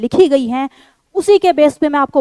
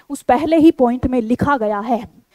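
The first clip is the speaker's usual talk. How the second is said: ordinary delivery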